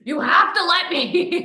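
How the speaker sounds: hammed-up eager begging; Shiloh begging. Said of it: "And Shiloh's like".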